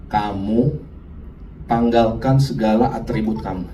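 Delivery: low, measured voice of God; Tuhan memerintah. Acted. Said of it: "Tuhan ngomong saya begini". (suara Tuhan rendah, memerintah dengan khidmat)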